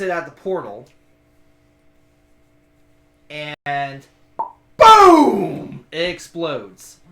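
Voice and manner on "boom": loud mock explosion, boom; shouted explosion sound effect. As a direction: belt out a big boom. GM makes the explosion noise.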